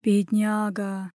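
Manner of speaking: slow, drawn-out pity. Acted. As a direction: draw it out in sympathy. Bystander pities the prince.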